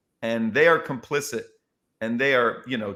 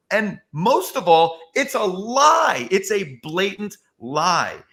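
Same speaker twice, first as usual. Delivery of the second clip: slow, weighty, each word pressed; louder, drawn-out insistence on it's a lie; emphatic retold plea; 6 semitones higher, 4 dB louder, slower than usual. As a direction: hammer home that it's a lie, slowly. Retold pleading with comedian.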